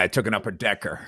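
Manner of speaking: low, deadpan grumble; Florentine impression. S0 continues Florentine voice.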